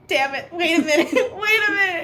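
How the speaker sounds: high, loud, laughing exclamation; laughing mock panic; mock alarm. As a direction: act caught off guard, laughing through it. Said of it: "and I'm like".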